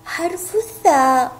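ordinary delivery

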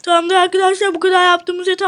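normal talking voice

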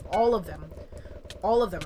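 All punched loud; stress on All. Real, not acted. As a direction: hit the word All hard. Insisting on all, repeated louder.

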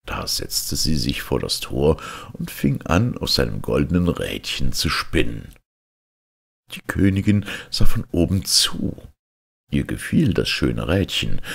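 ordinary delivery, nothing marked

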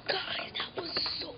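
excited whisper to the camera; breathless whispered report. Real, not acted. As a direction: hush it like a secret. Telling camera after loud prank.

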